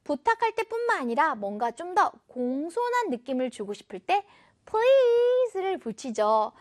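ordinary delivery

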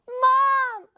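high, loud wail for mom; hammed-up tearful child calling out; mock crying. Upset child hearing mom's voice, wailing theatrically. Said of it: "엉엉엉 더 크게 막 울었죠".

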